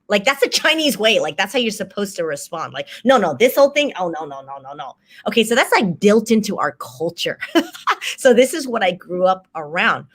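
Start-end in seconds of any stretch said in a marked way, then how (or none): 3.0-5.0 dismissive modesty. Acted. Showing how one must respond to praise, dismissive. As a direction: brush off praise, fading as you go. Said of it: "being dismissive of any compliment".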